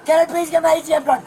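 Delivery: loud, raw begging; screaming plea. Real, not acted. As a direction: scream the begging at full force. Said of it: "You wanna scream at me some more?".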